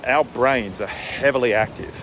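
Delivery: ordinary talk, nothing marked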